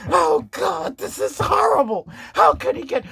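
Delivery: put-on high, whining old woman; hammed-up Pelosi despair, up high; mock Pelosi wail. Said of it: "Nancy goes".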